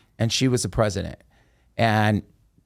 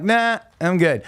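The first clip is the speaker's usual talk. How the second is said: a slow, higher, easygoing no-thanks; breezy refusal; 7 semitones higher than usual. Retold or imitated. Turning down the Ouija board with a slow, higher, breezy no. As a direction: wave off the idea lightly, unbothered. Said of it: "but I'm like".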